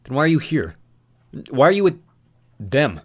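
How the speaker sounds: urgent question. Acted. Thomas pleading with Newt.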